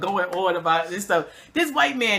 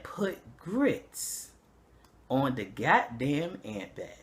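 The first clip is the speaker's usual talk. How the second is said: low, slow Southern man; lower, slower, deadpan country advice; 8 semitones lower, 8 dB quieter, much slower than usual. Retold or imitated. Old Southern neighbor giving ant advice, low slow drawl. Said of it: "He said".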